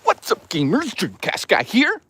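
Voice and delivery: high-pitched, hyped streamer greeting; exaggerated high, drawn-out YouTuber intro; Dreamcast guy. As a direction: push it way up high and stretch the sign-off. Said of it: "Dreamcast guy sounds like this".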